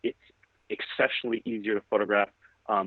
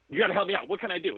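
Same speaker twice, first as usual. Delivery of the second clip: retold panicky plea, pitched high; a mild mock panic begging for help; freaking out; 10 semitones higher, faster than usual. Young photographer begging his mentor for help, mock panic. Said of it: "I'm freaking out".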